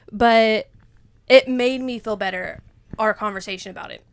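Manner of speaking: ordinary talk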